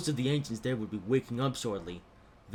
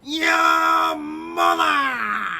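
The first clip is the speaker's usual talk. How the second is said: drawn-out screaming insult; 9 dB louder, much slower than usual. Acted. Train shouts insult.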